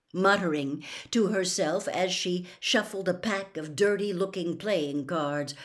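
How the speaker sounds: plain reading voice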